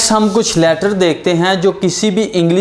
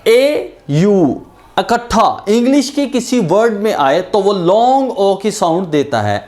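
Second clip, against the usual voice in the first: ordinary delivery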